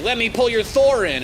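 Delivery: high, loud mock voice of the enemy player; mocking enemy quote. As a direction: squeal the enemy's line mockingly. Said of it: "He's like".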